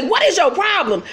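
high, affronted demand; mock indignant outcry. Dezza after being slapped, imitated high indignation. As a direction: imitate slapped outrage with pitch jumping up. Retold quarrel after the slap.

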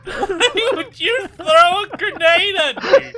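laughing disbelief. Real, not acted. Betrayed by teammate grenade.

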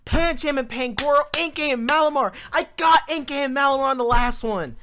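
loud, high, fast complaint; fast loud frustrated rant; indignant rant. Real, not acted. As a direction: rattle off the missed names in outrage. Ranting about missed answers.

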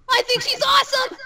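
high, loud burst of admiration; excited gushing. Acted. Gushing about admired Undyne.

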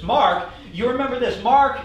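ordinary delivery

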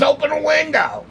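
high-pitched imitation of an urging friend; retold high urgent voice. Friend urging him in the car.

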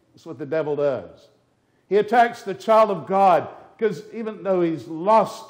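loud, high pulpit thunder; fiery preaching. Acted. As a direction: preach it at full force to the congregation. Preaching about the devil's vengeance.

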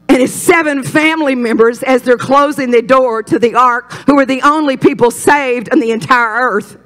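high, driving pulpit delivery; emphatic sermon climax; full-force preaching. Real, not acted. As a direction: preach it hard, pitched well up.

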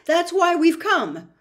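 high, loud and breathless; breathless fear. Acted. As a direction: gasp it out, terrified. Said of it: "breathing very fast".

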